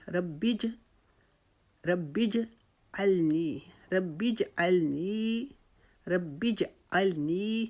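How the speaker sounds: ربی جعلنی very slow, the ج bounced back in the throat; qalqala. Shown as a demonstration. Teacher demonstrating qalqala on ربی جعلنی. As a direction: slowly recite ربی جعلنی with an echoing ج. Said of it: "ربی جلنی نہیں پڑھیں گے".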